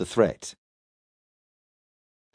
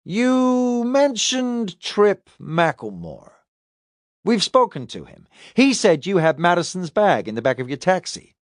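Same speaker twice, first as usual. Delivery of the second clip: higher-voiced detective, drawing out the first words; interrogating detective; 7 semitones higher than usual. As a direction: confront the suspect with a witness's account. Detective presses on with questioning.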